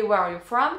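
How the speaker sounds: textbook English question, close to own voice; teacher's English. Retold or imitated. English teacher asking her pupil, imitated classroom English. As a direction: ask it as an understandable classroom phrase. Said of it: "Moi, ma prof, quand elle me demandait".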